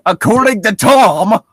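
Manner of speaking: sudden loud high yell; mock shout. Friends mocking the story, mock shout. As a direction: blurt it out in a shout.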